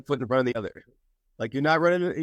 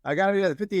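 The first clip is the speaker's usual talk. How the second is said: desperate player; 6 semitones higher, much faster than usual. Retold or imitated. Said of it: "He's like".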